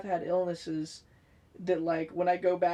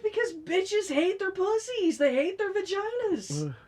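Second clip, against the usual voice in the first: exasperated blunt answer; 11 semitones higher than usual. Retold or imitated.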